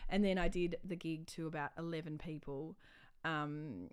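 ordinary talk, nothing marked

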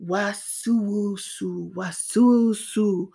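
slow, drawn-out slither; imitated slithering python; slithering sound. A narrator's reading. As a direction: stretch it out like a sliding snake. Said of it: "who came slithering".

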